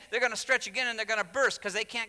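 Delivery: high, urgent sermon delivery; impassioned preaching. Real, not acted. Preacher explains the parable of old skins, high-pitched and impassioned.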